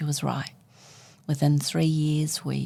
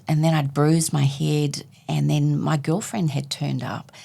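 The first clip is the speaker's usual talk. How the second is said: ordinary talk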